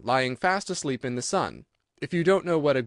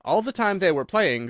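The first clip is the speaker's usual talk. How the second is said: plain reading voice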